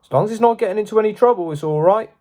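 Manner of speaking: quick, lightly voiced father; a hint of an easygoing dad, rushed. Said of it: "said his father".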